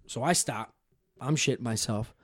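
ordinary delivery

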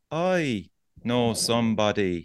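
slow, drawn-out mock neighbour; slow talker. Neighbour telling a long story, mocked as very slow. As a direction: stretch each word like a slow talker. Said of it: "one of those slow talkers".

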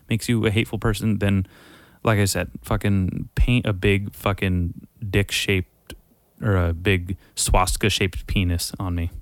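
ordinary delivery

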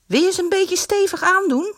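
high sweet asking; girlish voice. A narrator's reading. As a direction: ask him politely. Girl voice in the story.

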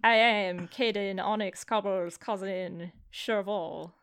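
hammed-up fake French accent, slow; a deliberately bad French accent for the cousin. GM voicing the French cousin in a bad French accent. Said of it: "I need to hear your French accent immediately".

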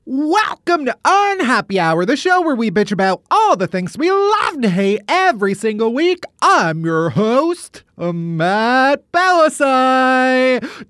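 drawn-out, swooping show intro; over-the-top show announcer. Pretend. Host opening the comedy show, over-the-top announcer. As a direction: stretch the host's name out long and high.

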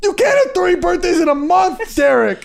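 high-pitched ranting yell; hammed-up indignant tirade; mock outrage. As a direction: rant at him, loud and high, half laughing. Mock ranting at Derek, high and loud.

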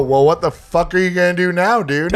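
loud, high taunting dare; retelling his own loud dare to the stranger; cocky challenge. Retold or imitated. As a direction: throw it in his face after dumping the beer. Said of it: "And I go".

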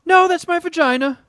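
high, put-on voice of the skater; mock girl's voice. Co-host joking as the hurt skater, high mock distress.

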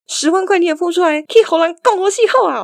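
high-pitched exasperated telling-off; mock scolding. Mum telling off the scammer before hanging up, mock scolding. Mother tells off the scam caller.

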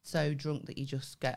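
normal talking voice

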